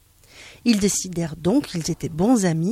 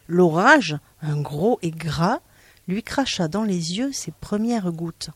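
ordinary delivery

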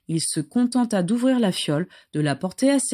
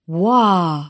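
an awed, louder wow; breathed wow; 5 dB louder than usual. Acted. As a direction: let the wonder out. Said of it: "souffla Sirius".

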